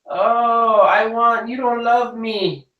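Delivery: put-on high, whining little girl, drawn out slowly; a sulky little girl, hammed-up and high; whiny child. Daughter's tantrum imitated.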